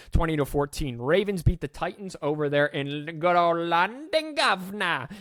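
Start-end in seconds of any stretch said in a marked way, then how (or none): 2.2-5.1 lightly put-on English accent, half-joking; a deliberately terrible English accent; bad English accent. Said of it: "Terrible English accent".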